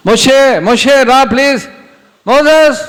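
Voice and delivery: high, loud call from afar. Acted. God calls Moses from the burning bush, loud and high. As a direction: call his name across the desert.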